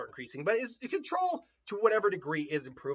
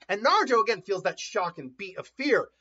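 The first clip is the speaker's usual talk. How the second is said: ordinary delivery, nothing marked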